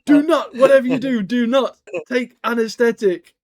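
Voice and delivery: high, loud, laughing plea, slowing at the end; mock panicked warning. Mister Moon in hospital warning about anaesthetic, high mock panic. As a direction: beg them not to, hamming up the fear of needles. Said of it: "He's like".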